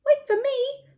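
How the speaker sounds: high little squeak; high squeaky call after the family. A narrator's reading. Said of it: "squeaked Lettuce".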